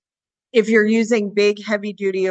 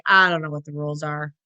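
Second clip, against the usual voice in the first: lower, fast voice for the dog; confused dog voice; 6 semitones lower, faster than usual. Retold or imitated. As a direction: speak as the bewildered dog, low and rushed. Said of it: "The dog is like".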